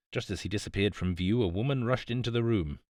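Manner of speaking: plain reading voice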